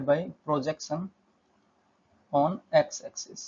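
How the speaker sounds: drawn-out words, long pauses between chunks; slow, halting board dictation; dictating while writing. Real, not acted. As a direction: say each piece slowly as you write it. Saying words while writing them out.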